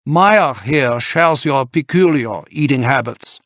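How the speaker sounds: German climber finding fault; disapproving German climber. A narrator's reading. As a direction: pass judgment in an imperious German voice. Freitag's German character voice, disapproving.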